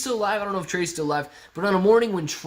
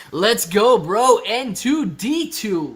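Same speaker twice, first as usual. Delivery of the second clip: hype cheer; 6 semitones higher, 7 dB louder, much slower than usual. Real, not acted. Cheering the stock on.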